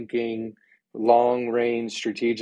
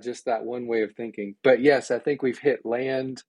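usual speaking voice

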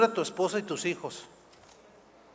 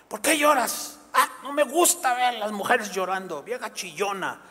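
mock gruff husband. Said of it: "husband scolding crying wife".

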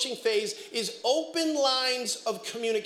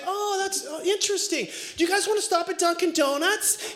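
higher, breezy unbothered mom; casual wife; 8 semitones higher than usual. Retold or imitated. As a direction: act calm, as if nothing happened. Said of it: "and she's like".

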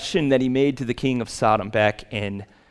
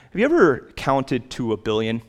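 ordinary talk, nothing marked

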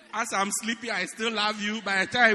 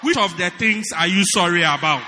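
wife's loud grilling questions; mock interrogation; 7 dB louder than usual. Preacher voicing the wife, grilling her husband loudly. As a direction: grill him, louder and louder.

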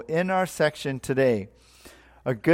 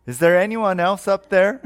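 raised, high hopeful call upward; acted calling out; 6 semitones higher, 5 dB louder than usual. Hanging man seeks another rescuer, calling up in mock appeal. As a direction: call past the first voice for a second opinion.

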